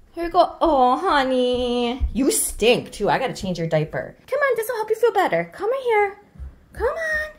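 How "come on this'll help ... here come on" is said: higher sing-song coaxing; lightly voiced baby coaxing. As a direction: coax the baby to drink. Coaxing baby to take medicine.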